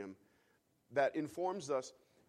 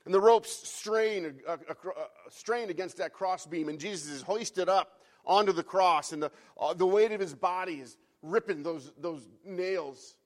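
louder, higher, straining narration; intense preaching; 4 semitones higher, 9 dB louder than usual. Acted. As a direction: drive the scene with rising force. Preacher building the crucifixion scene.

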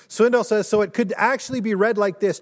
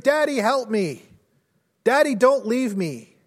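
raised, pleading cry, short of a full scream; retold child's scream; 6 semitones higher, 4 dB louder than usual. Frightened son calls to his father before surgery, mildly retold scream. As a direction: imitate a frightened child calling out, restrained. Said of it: "just screamed".

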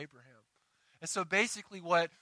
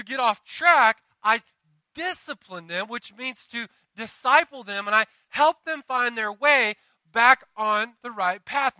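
loud, high, emphatic sermon delivery; punchy, forceful pulpit proclamation; impassioned preaching; 6 semitones higher, 9 dB louder than usual. Real, not acted. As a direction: preach it with raised voice, leaning on key words.

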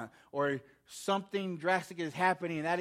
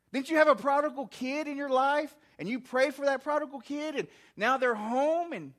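louder, higher rhetorical question; challenging reproach; 7 semitones higher, 6 dB louder than usual. Acted. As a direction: preach it as God's insistent reminder. God's challenging questions, voiced by preacher.

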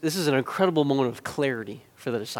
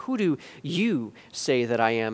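YOU set apart as the pointed word; a probing question weighted on YOU; stress on YOU; 5 semitones lower, 5 dB quieter than usual. Retold or imitated. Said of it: "that inflection within my voice".

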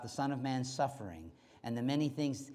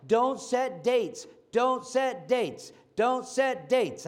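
slow, high, punched repetition of each word; insistent preacher's refrain; slow emphatic warning; 7 semitones higher, 5 dB louder, much slower than usual. Acted. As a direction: hammer each "don't set dates" home slowly. Repeated warning to the congregation.

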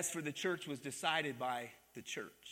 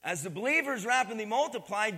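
louder preaching push on "believers" and "multiplied"; emphatic re-read; 4 semitones higher, 6 dB louder than usual. Shown as a demonstration. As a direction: drive the verse home to the congregation. Verse re-read with force.

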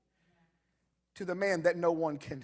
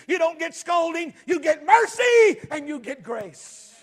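rising preacher's cadence; 8 semitones higher, 12 dB louder than usual. Acted. Preacher urging the congregation to tell the story, building to a shout. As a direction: preach it louder and louder, landing hard on mercy and grace.

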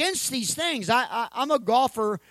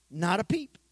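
usual speaking voice

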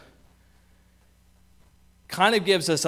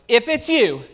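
calling out; 6 semitones higher, 6 dB louder than usual. Acted. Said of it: "Peter cries out".

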